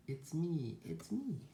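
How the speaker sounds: high-pitched little duck voice; duck character. A narrator's reading. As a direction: reveal yourself as a storybook duck. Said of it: "says the duck".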